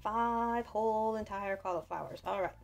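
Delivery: normal talking voice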